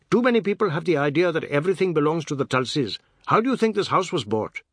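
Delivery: high, loud, fast-starting tirade; brash Seth, accusing. A narrator's reading. Seth up to the veranda in Hindi, accusing. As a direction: call the accusation up at them.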